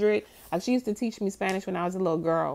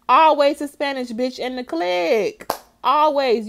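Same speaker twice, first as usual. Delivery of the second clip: higher and louder, hammering the point home; loud emphatic repeat; 5 semitones higher, 5 dB louder than usual. Real, not acted. Host repeating her point to co-host, louder and higher. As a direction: punch it out like it's settled fact.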